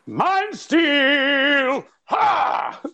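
very high, loud hammed-up product shout; mock infomercial announcer. Selling a fake mind-control product, high hammed-up shout. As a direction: belt it like a cheesy ad.

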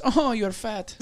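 retold Romanian accent. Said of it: "was actually a Romanian chick".

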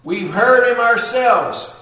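loud, high screaming high priest; a furious judge screaming his head off. Acted. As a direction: scream it in a fit of rage. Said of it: "he screams".

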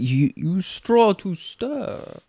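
put-on very slow broken English accent, spelling it out; broken English barman. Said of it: "I'm not gonna do the accent".

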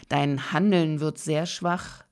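ordinary talk

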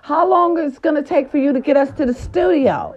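playing her part. Vlogger replaying her excited answer to Snooki. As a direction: gush like you're thrilled to host her. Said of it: "I was playing my part".

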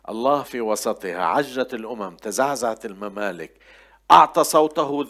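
loud, emphatic psalm reading; proclaiming scripture. Acted. Reads psalm aloud forcefully. (تلاوة جهورية للمزمور)

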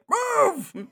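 high, loud mock yell; mock shouted order. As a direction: imitate someone yelling an order at a recruit. Retold order barked at recruit.

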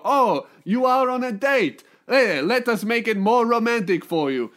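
hammed-up Italian accent, playing the charmer; mock Italian accent. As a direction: ham up an Italian romancer's accent. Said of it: "the Italian dudes go".

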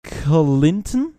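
loud, slow mock American English; imitated American woman. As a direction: stretch the words out like an American pronunciation coach. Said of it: "war hier so ein Video und die hat gesagt". (amerikanisches Englisch, laut und gedehnt nachgeahmt)

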